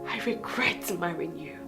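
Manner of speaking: very low, soft confession; hushed regret. Acted. Wife telling husband she regrets marrying him, hushed. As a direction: murmur it in bitter regret.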